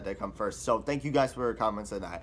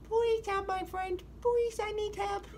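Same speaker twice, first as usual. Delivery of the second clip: very high little cat voice; slower than usual. Acted. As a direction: squeak it like a tiny kitten. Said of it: "hear me do my little cat voice".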